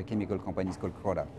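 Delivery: ordinary talk, nothing marked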